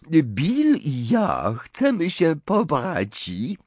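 put-on high, slow, French-accented announcement; proud announcement. A narrator's reading. Fleur announces her engagement to Harry, proud. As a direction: draw out the news in a foreign lilt.

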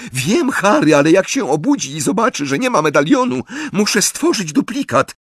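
high, pressing character voices; urgent alarm. A narrator's reading. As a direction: speak up high, under mounting pressure. Frantic exchange as Dementors close in.

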